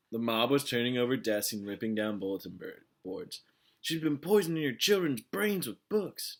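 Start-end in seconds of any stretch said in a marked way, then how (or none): 3.8-6.3 high, loud rabble-rousing voice; loud declaration. A narrator's reading. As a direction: whip the crowd up. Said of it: "Trout declared".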